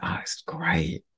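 slow, hushed whisper of awe; awed whisper. Real, not acted. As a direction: breathe it out in wonder. Awed by the scene on screen.

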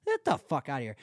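usual speaking voice

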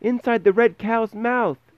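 put-on very high little-boy shout; tiny Tom answering his mother from the cow. A narrator's reading. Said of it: "Tommy shouted".

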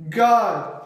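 loud, high cry to heaven; wailed prayer. A narrator's reading. Edmund prays in tears, loud wail. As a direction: cry out in desperate plea. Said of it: "I wailed".

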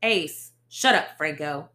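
loud, cutting shutdown; loud snap. Acted. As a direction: shut his partner down hard. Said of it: "he said".

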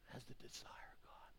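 slow, hushed whisper of grief; sorrowful whisper. Acted. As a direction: whisper it slowly like a broken heart. Jesus's whispered question.

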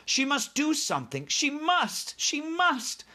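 very high, mounting urgency; urgent desperation. A narrator's reading. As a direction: think aloud in a high, desperate voice. Heroine's racing, desperate thoughts.

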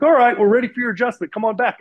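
high, loud, cheery call-in; singled-out high voice calling across a waiting room; chiropractor calling patient. Retold or imitated. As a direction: imitate a breezy practitioner summoning the next patient. Chiropractor calls him in.

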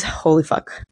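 breathless whispered shock; awed whisper. Retold or imitated. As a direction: whisper it, stunned. Shock stepping out of the shower.

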